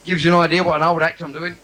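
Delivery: ordinary talk, nothing marked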